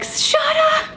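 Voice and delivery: squeaky high-pitched "Shut up"; mock squeal. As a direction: let it fly out as a shrill cartoon squeak. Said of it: "Deep down, I just wanna be like".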